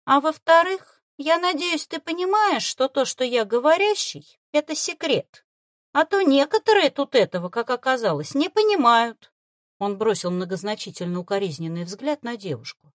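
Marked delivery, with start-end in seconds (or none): 0.1-9.3 higher, louder patronizing lecture; condescending. Acted. Fox warns the boy about the secret, condescending. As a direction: talk down to the boy. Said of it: "снисходительно добавил".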